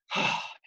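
long unvoiced sigh; release sigh. Shown as a demonstration. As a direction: let the day's weight out in a sigh. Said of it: "letting it out from their day".

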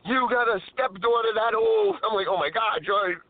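ordinary talk

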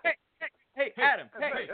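high, hammed-up yelping hails; Hey Adam call. Leading everyone on the count of three, high mock calling. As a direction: yelp it like an over-eager fan. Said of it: "let's do the "Hey Adams".

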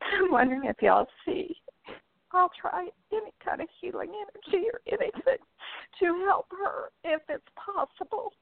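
high, choked-up asking; tearful plea. Real, not acted. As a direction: beg for help, close to tears. Caller near tears about dog.

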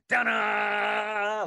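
loud, high sung fanfare as the lights come up; mock fanfare. Storyteller mimics the music revealing Tom Cruise, loud mock fanfare. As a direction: trumpet a big reveal sting.

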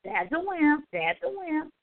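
mock children jeering at their father; taunting kids. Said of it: "his children were looking at him like".

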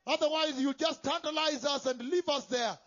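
normal talking voice

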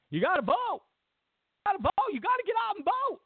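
high pleading vote call; mock Obama urging. Mocking Obama pleading with voters, high pitched. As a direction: beg the crowd to go vote. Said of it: "doing his".

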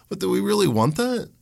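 usual speaking voice

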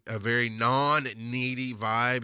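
usual speaking voice

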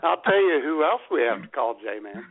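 ordinary talk, nothing marked

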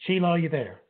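loud call down the line; shouting into a phone. Real, not acted. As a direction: raise voice to reach caller. Calling guest on bad line.

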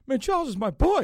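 high, loud, comic wail; mock near-crying. Retelling Spike Lee upset over a trade, mock tearful wail. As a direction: imitate an upset fan about to cry. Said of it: "I thought he was gonna start crying".

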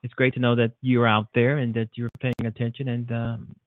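ordinary talk

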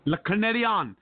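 Canadien with a French ending; French pronunciation. Shown as a demonstration. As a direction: firmly show Canadien the French way. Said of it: "That's how you say the na".

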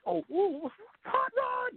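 high, squealing fanboy cry; excited fan squeal. Retold or imitated. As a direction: squeal the name like a starstruck fan. Fanboys calling out.

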